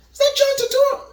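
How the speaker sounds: high, startled double take; surprised disbelief. Retold or imitated. To himself, spotting John Turturro on screen, surprised. Recognizing actor in theater.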